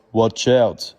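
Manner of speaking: loud warning cry; calling out. Acted. Arthur warning the fighting children, loud. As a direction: shout a quick warning. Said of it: "cries Arthur".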